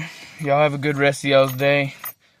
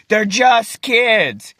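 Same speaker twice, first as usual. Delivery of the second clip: drawn-out, higher-pitched mock protest; retold attack; 4 semitones higher, much slower than usual. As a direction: imitate the other team, stretching "just kids". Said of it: "The other team starts attacking me".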